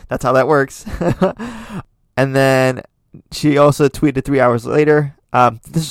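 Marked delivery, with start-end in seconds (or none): 0.9-1.8 high laugh. Real, not acted. Laughing at her own joke.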